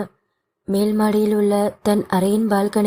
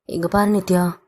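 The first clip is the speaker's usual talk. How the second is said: father's voice. Acted. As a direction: plead gently as her father. Father consoling daughter.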